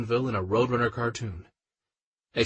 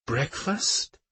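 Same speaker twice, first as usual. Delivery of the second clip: suspicious echo; 5 semitones higher than usual. Acted. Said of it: "she echoes".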